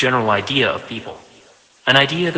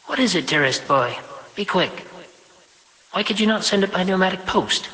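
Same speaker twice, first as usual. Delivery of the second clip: higher, prim voice of a self-important mother; higher, brisk mother granting her son five minutes; busy mother, briskly obliging; 7 semitones higher than usual. Acted. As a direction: fit your son into a tight schedule, higher. Vashti questioning her son.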